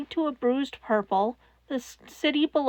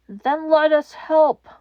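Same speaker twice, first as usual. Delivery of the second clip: earnest plea; 4 semitones higher, 7 dB louder, slower than usual. Acted. All Might pleading slowly.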